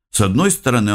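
plain reading voice

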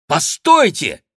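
sudden high, loud call; exclaiming. A narrator's reading. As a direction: cry out to stop someone. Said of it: "воскликнул Сен-Люк". (громкое восклицание)

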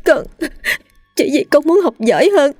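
high, sobbing justification; tearful explanation. A narrator's reading. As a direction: explain to mother through heavy tears. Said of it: "nói trong nước mắt". (nói trong nước mắt, nức nở)

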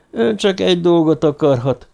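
hoarse, almost unrecognisable. A narrator's reading. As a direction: say it as if your voice has gone hoarse. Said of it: "A hangja reket volt, szinte fölismerhetetlen".

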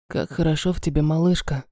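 low, soft velvety male voice; бархатный голос. Acted. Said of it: "сказал он бархатным голосом". (бархатный низкий мужской голос)

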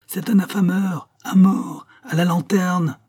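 rising crowd clamour; crowd repeating the cry. A narrator's reading. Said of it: "répétaient de confiance".

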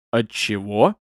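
put-on calm, curious old man; interested. A narrator's reading. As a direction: ask with mild interest. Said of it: "с интересом спросил он".

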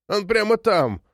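insist, refusing to be calmed; insistent, agitated. A narrator's reading. Old woman won't calm down, agitated and insistent. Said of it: "не унималась старуха".

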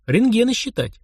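usual speaking voice

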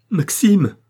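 loud, high call of a name; sharp exclaimed shout; sudden cry. A narrator's reading. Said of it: "proféré soudain".

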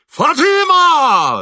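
long, high, full-force call; terrible yell. A narrator's reading. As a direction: bellow the name, stretching it out high. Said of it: "закричал Шаринов страшным голосом". (страшный протяжный крик)

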